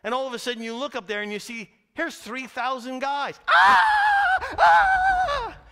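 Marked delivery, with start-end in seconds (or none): 3.5-5.6 two high, loud panicked screams; terrified screams. Acted. Preacher voicing fleeing soldiers, terrified scream. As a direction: scream like men fleeing for their lives.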